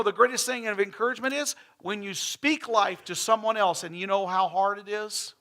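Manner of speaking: loud, ringing sermon voice; full preaching. Acted. Pastor preaching to his church about giving, loud and ringing. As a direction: preach it out to the whole congregation.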